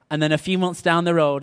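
ordinary talk

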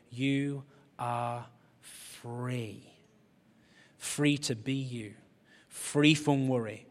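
slow, soft weighty delivery; slow emphasis. Real, not acted. Preacher slowly stressing freedom to the congregation. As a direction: let each word land.

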